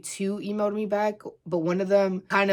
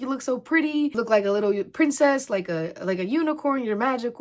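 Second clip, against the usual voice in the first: put-on higher sing-song voice for a child; talking to a little girl; 5 semitones higher than usual. Vendor complimenting a little girl at her booth, higher sweet voice. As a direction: coo compliments at a small girl. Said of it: "I'd be like".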